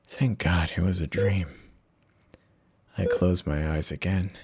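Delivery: plain reading voice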